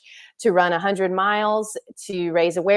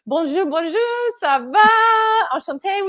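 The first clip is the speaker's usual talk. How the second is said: high, bright sing-song Bonjour, rising louder to ça va; excited French greeting; 13 semitones higher, 6 dB louder than usual. Real, not acted. Host welcoming guest on stage, high excited French hello. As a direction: greet her gaily in French, climbing up.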